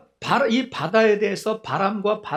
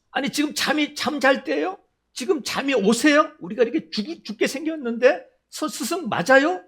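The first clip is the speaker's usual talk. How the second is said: higher, agitated pleading in a mock panic; alarmed disciples; 6 semitones higher than usual. Disciples waking Jesus in the storm, mock alarmed pleading. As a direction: shake the teacher awake, half-hearted alarm.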